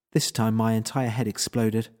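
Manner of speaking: ordinary delivery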